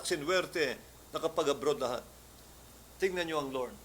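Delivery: ordinary talk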